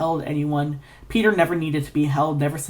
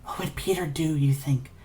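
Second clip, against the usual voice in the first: whisper; 5 dB quieter than usual. Acted. Narrator's anxious inner thought.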